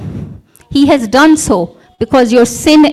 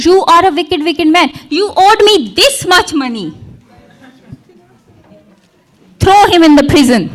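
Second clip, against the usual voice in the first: mock angry; 2 semitones higher than usual. Servant grabbing his debtor by the neck, mock fury. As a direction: bark the order to throw him in prison.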